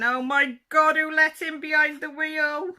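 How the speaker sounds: high, loud, slowed mock alarm; cat voice. Cat in the picture reacting to a gnome driving, mock alarm. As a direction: shriek it like a horrified cat. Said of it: "the cat's saying".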